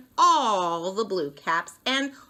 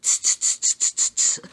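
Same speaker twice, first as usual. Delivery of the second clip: whispered tsk tsk chatter; monkey tsking; 4 dB louder, much faster than usual. Acted. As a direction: tut back like monkeys. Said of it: "and said".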